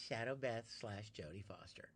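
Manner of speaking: whispered aside, breathy and soft. Real, not acted. Streamer crediting a viewer in a hushed whisper. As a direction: drop to a secret whisper.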